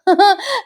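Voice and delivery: sharp rising giggle; high laugh. Real, not acted. Host laughs at the aunt's wailing, real giggle. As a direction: burst out laughing.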